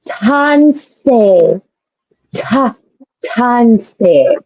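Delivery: Tanse with a hard aspirated English t; learner's English-T tanse, mildly mocked; English T imitation. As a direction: mimic a learner saying tanse with a puffed t. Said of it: "so used to the English T".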